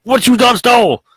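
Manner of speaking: high, loud hammed-up inmate shout; mock inmate yell. Inmate yelling at the kid, loud, high and hammed-up. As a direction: bark it like a jailhouse bully, way up high. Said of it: "He's like".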